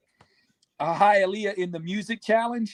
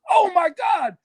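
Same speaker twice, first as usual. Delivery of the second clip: higher, shouted amazement; excited shout; 9 semitones higher than usual. Retold or imitated. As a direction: yell it out at a giant turtle. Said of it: "He goes".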